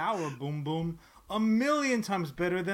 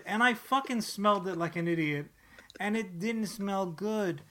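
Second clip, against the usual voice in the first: usual speaking voice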